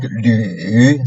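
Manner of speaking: LÜ dipping low, then a little up; falling-rising LÜ; third tone. Shown as a demonstration. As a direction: push LÜ down low before lifting it slightly. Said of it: "you really low down your pitch".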